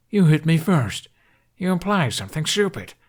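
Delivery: loud, high bickering; mock squabble. A narrator's reading. Dragon and rider bickering in flight, loud and high. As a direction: snap back like squabbling brothers.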